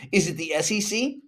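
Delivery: normal talking voice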